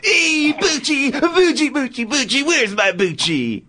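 high, slow sing-song dog call; John Travolta impression. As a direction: coo for the dog, stretched out. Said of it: "And action".

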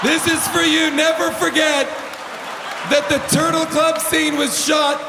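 hammed-up public address, high and insistent; mock rallying tribute. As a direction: proclaim it to the crowd like a grand tribute. Mock tribute to New York crowd.

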